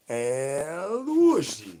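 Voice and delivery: very slow and high, each syllable stretched long; drawn-out El Wushy call. Pretend. Streamer performing the El Wushy call chat asked for, slow and high. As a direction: croon the name out slowly like a summons.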